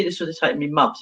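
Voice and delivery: ordinary talk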